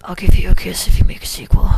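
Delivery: forceful stage whisper; loud whisper. Acted. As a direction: whisper it, teasing and flirty. Whispered kiss offer.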